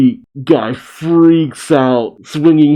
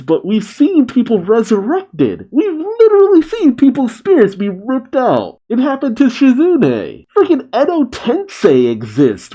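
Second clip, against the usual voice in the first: high-pitched, worked-up rant; incredulous outburst; 12 semitones higher than usual. Real, not acted. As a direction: burst out as the obvious suddenly hits you. Sudden realisation, ranting at plot hole.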